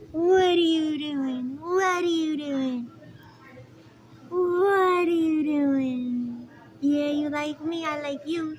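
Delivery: high, loud sing-song cooing at a bird; pet talk. Real, not acted. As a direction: coo to the parrots like babies.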